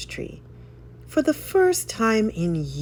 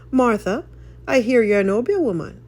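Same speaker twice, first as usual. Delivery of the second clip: higher, louder, brisk and curious; a neighbour sounding her out, quickly; Mister Bennet; 6 semitones higher, 4 dB louder than usual. A narrator's reading. Mister Bennet to Martha, quick and curious.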